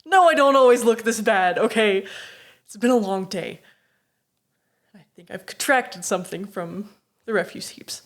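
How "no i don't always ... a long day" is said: loud, laughing mock outrage; hammed-up indignation, loud and laughing; playfully insulted, protesting loudly; mock affronted. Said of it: "I'm, like, affronted".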